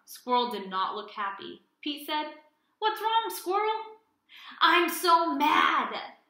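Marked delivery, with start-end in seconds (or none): 2.8-4.0 concerned question. Acted. Pete asks with concern. 4.6-6.2 slow, louder, drawn-out grumble; playful pretend anger; mock mad squirrel. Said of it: "Squirrel is feeling mad".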